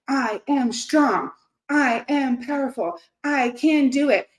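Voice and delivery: self-affirmation. Retold or imitated. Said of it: "You say".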